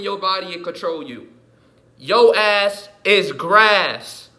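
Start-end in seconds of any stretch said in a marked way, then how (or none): 2.0-4.3 very slow, loud and high; loud drawn-out warning. Real, not acted. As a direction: stretch every word as a threat. Warning listener.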